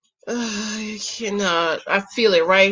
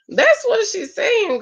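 emphatic; 11 semitones higher, slower than usual. Retold or imitated. Emphatic mock aside.

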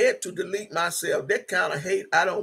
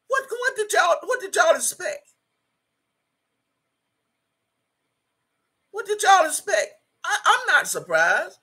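high, exasperated "what did y'all expect"; incredulous; 10 semitones higher than usual. Real, not acted. Scolding viewers shocked by the bullies, high and incredulous. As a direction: throw it up high in disbelief.

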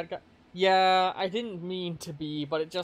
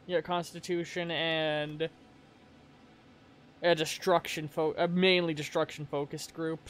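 normal talking voice

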